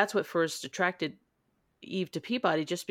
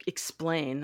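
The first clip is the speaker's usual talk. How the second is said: usual speaking voice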